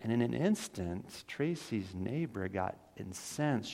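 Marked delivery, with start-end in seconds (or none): none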